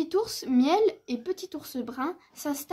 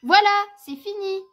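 high, loud little-cub exclamation; proud announcement; 6 semitones higher, 9 dB louder than usual. Acted. Little bear showing his finished snowman, proud and excited. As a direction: announce the finished work with delight.

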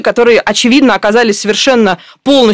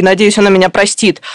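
normal talking voice

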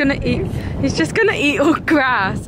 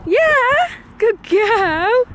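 high shouted cheer; high excited praise; cheering yay; 10 semitones higher, much slower than usual. Real, not acted. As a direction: celebrate the dog's jump. Dog completes jump.

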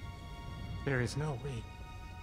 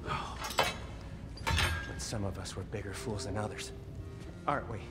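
slow, bitter and sneering; bitter sarcasm; 6 semitones lower, slower than usual. Acted. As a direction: drag it out with contempt.

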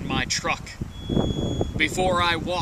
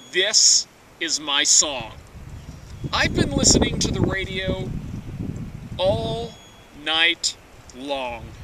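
normal talking voice

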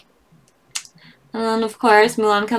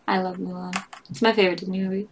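ordinary talk, nothing marked

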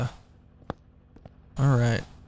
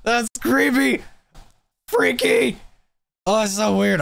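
excited; 7 semitones higher than usual. Real, not acted. Player reacts to villain.

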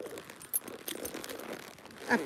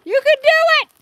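much higher, loud cheering yell; shouted encouragement; 12 semitones higher, 9 dB louder than usual. Real, not acted. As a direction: holler across to the rider. Yelling to another rider.

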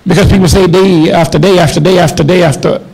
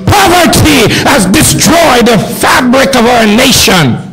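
preaching shout; 4 semitones higher than usual. Acted. Preaching climax.

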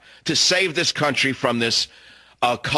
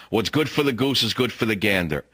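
ordinary delivery

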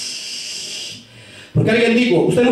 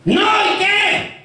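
defiant retort; 6 semitones higher than usual. Acted. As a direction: fling it back brazenly in a high pitch. Acts out spouse's defiant retort.

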